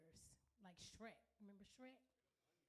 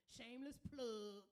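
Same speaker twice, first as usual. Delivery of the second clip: shameless plug called out loud; playful loud announcement; 3 semitones higher, 8 dB louder than usual. Shown as a demonstration. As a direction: punch it like an emcee hype.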